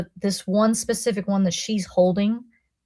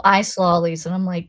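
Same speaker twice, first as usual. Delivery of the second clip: normal talking voice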